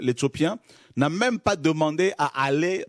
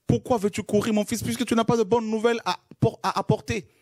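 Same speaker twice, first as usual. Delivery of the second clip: Joab's question pitched higher and quicker; higher, faster retake; 9 semitones higher, faster than usual. Shown as a demonstration. Preacher rereads Joab's question to the congregation, higher and faster. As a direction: repeat it up high and faster.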